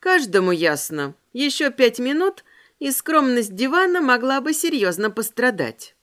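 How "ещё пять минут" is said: ordinary delivery, nothing marked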